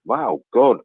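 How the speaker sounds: normal talking voice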